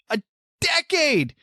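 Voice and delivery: high-pitched, drawn-out exasperation; exasperated outburst. Real, not acted. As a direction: cry it out slowly, high and fed up.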